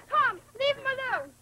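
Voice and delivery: calling out. Acted. Sister intervenes in fight.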